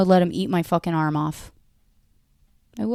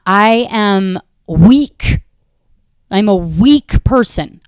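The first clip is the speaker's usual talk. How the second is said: slow, louder, each word weighted; slow louder mock-tragic admission; emphatic confession; 7 dB louder, much slower than usual. Real, not acted. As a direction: lean hard on every word, slowly. Admitting weakness.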